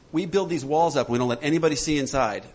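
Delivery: ordinary talk, nothing marked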